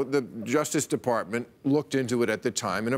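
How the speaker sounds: normal talking voice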